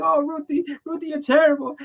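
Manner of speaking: mock Ruthie like a giggling kid; teasing kid voice. Imitating Kevin laughing at Ruthie.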